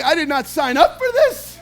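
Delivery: mock-despairing dad, laughing; laughing exasperation. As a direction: laugh it out, overwhelmed. Overwhelmed dad complaining.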